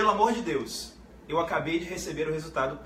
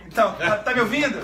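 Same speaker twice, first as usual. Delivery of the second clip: chamando ao telefone; 3 semitones higher, 7 dB louder than usual. Real, not acted. Ligação ruim, chamando o convidado.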